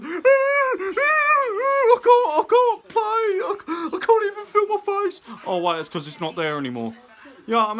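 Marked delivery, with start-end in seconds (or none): 0.0-5.2 high, loud, whimpering fake sobs through the words; hammed-up mock howling of a man with a broken face; mock crying injured player. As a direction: ham up a wailing baby-like athlete in pain.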